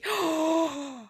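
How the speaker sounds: long, high intake of breath; excited gasp. Real, not acted. Picturing fish going underneath the tunnel, excited gasp. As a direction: gasp in delighted anticipation.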